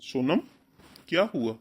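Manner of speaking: loud, rising alarmed questions; startled father calling out to his daughter; alarmed concern. Acted. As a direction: rush in worried at her crying.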